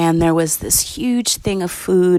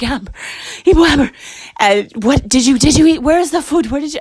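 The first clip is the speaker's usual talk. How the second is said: urgent alarm, voice pitched way up; panicked and breathless; 8 semitones higher, much faster than usual. Retold or imitated. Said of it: "she was, like, out of breath".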